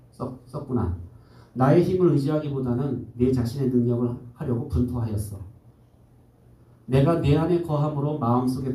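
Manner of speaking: calm, fatherly divine voice; voice of God. Acted. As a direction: speak as God gently correcting His child. Reads God's inner voice aloud.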